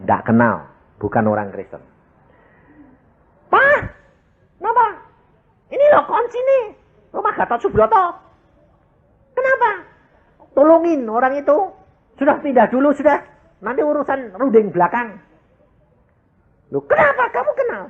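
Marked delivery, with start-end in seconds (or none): 3.5-15.2 high, quick voice of the landlord; landlord's voice, pitched well up. Retold or imitated. 16.7-17.8 high questioning wife; wife's voice, pitched well up. Retold or imitated.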